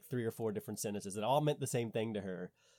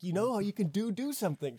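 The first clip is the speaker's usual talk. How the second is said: high-pitched, louder over-explaining; re-enacted explaining; 10 semitones higher, 4 dB louder than usual. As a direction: spell it out eagerly, pitched right up. Acts out explaining to French friend.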